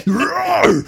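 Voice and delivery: loud high mock roar. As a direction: roar like a beast. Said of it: "I was just like".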